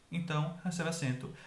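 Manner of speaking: usual speaking voice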